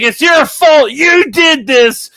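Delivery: high shouted accusation, hammed up; loud mock tantrum; mock angry blame. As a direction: shout the blame in put-on fury, dragging the last words. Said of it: "getting angry".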